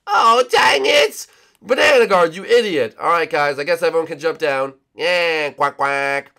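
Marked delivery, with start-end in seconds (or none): 0.1-3.0 loud, high furious shout; angry yelling. Acted. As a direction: yell at the fool. Angry at Banana Guard.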